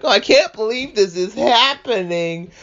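whiny Shinji. Retold or imitated. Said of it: "Shinji's just like".

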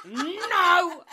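high, loud, panicked imitated cries of no; mum crying no. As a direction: mimic a frightened mum yelling no. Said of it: "I heard my mum go".

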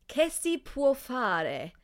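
slow, careful Italian accent; drawn out Italian pronunciation. Shown as a demonstration. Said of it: "pardon my terrible Italian accent".

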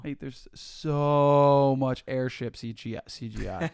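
loud, drawn-out complaint; exasperated rant. Real, not acted. Co-host sick of airship CGI, exasperated. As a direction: lean hard and slowly on the words. Ranting about too many airships.

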